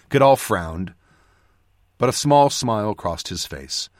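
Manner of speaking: plain reading voice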